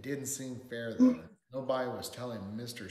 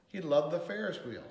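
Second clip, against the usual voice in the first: ordinary delivery, nothing marked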